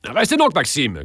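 high-pitched cry to stay; calling out. A narrator's reading. Count calling Maxime back to the room, calling out. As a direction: call after him, pitch jumping up then falling. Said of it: "cria le comte".

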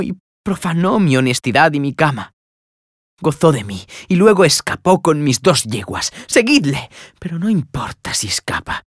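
put-on high, impassioned lament of a betrayed fisher girl; dishonored young woman lamenting. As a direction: cry out your shame and fury. Tisbea laments her dishonor.

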